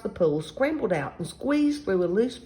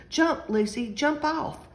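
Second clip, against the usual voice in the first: high-pitched mock holler; urgent storybook call, volume held back; 9 semitones higher, slower than usual. A narrator's reading. Children warn Lucy on her skateboard, calling out. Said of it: "called out at the top of their lungs".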